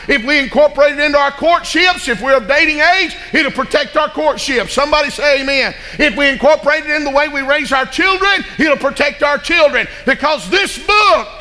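high, loud sermon cadence; full-force preaching. Real, not acted. Preacher exhorting the congregation on family life, full force. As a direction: drive it home like a revival preacher.

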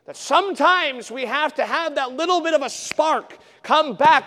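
loud, high, driving sermon delivery; fiery preacher calling the church to act; preaching at full force. Acted. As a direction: hammer the point home to the congregation. Preaching climax.

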